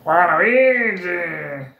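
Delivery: long, drawn-out cartoon character cry; Waluigi. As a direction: stretch the name out in a put-on game voice. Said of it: "This is what he sounds like".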